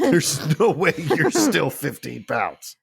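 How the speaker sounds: slow, incredulous scolding of a cat; mock disbelief. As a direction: drag it out in comic protest at the cat. Teasing the heavy cat being lifted.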